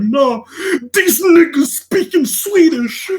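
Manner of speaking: retelling his own reply while barely holding in the laugh; laughing protest. Retold or imitated. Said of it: "And I'm like".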